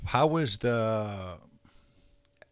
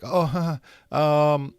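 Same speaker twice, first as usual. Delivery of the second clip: nervous stammer; 5 semitones higher, 6 dB louder, slower than usual. Retold or imitated. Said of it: "lack of confidence".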